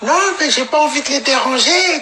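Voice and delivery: very high whiny begging voice; high squeaky parody of her phone call; mock pleading girl. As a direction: plead like a tipsy girl asking for a ride. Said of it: "her reply on the phone".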